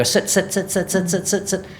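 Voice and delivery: high, repeated mock commands; mock nagging owner. Trainer imitating an owner nagging the dog, high and insistent. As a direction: rattle off sit like a flustered owner. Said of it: "we go".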